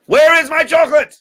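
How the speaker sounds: very high, loud demand; acted high-pitched tantrum; upset duke. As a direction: shout it in mounting outrage. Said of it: "He's getting all upset".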